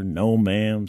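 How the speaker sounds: ordinary delivery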